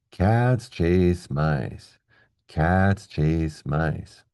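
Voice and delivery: slow, regular beat with every word drawn out; very slow and long. Shown as a demonstration. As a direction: stretch each of "Cats", "chase", "mice" over a full beat. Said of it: "They're all very slow and long".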